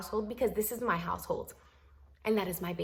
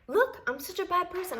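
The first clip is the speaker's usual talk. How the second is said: sarcastic; 10 semitones higher than usual. Retold or imitated. Sarcastic to husband, pitch up.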